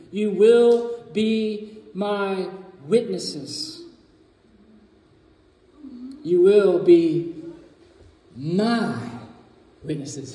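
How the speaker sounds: "will" drawn out, pausing between the words; slow, weighty quotation. Shown as a demonstration. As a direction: lay each word down slowly, "my" alone. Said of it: "Jesus speaking".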